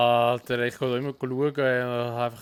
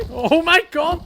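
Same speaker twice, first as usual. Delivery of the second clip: laughing shock; 9 dB louder, slower than usual. Real, not acted. Reacts to chaos.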